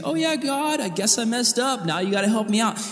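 high, breezy shrug of a sorry; flippant half apology. Retold or imitated. Pastor mocking a careless apology to God, high and breezy. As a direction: toss it off like it's no big deal. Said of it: "coming and saying".

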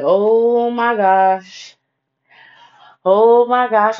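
drawn out, slow oh my gosh; mock shocked. Teller finding messages on friend's phone, slow stunned. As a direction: stretch it out in disbelief. Panicking over discovery.

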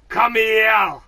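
hammed-up hug shout; Glaswegian shout. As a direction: shout it with arms wide open. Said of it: "He was like".

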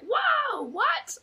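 very high-pitched imitated astonishment; exaggerated gasping disbelief; mock shock. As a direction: squeal it as stunned guests. Guests react to blackmail news.

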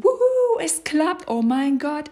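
high, loud whoop of delight; thrilled whoop. Retold or imitated. Coach reliving a first driving lesson, shrieking with joy. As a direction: squeal like you just drove for the first time.

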